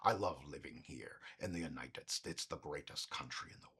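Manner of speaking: low, breathy, unvoiced patriotic mumble; whispered Neeson. Comic mimicking Liam Neeson's character, whispered low and proud. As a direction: hush it like a gruff hero. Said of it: "or he's".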